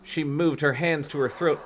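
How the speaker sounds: ordinary delivery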